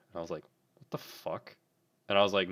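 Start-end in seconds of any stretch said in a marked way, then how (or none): 0.8-1.6 hushed, voiceless reaction; baffled, under the breath; whispered disbelief. Retold or imitated. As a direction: whisper it as a private thought. Silent reaction to odd request.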